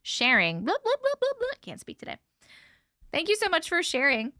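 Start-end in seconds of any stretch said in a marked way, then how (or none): 0.6-1.5 high, singsong blah-blah mockery; playful mock babble. Mocking filler chatter. 1.6-2.2 much lower and softer, barely there; a playful fake-speechless mumble; mock mute. Joking she can't talk.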